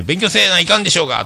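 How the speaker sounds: lightly acted Hakata mom telling her child to study; Hakata dialect scolding. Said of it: "博多弁で".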